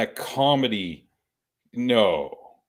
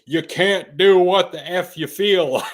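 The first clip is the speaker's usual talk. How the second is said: higher, louder mock scold; scolding the baby; 7 semitones higher, 7 dB louder than usual. Passenger scolding the crying baby, mock loud. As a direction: tell the baby off in jest. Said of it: "I'd go over to the baby and I'd say".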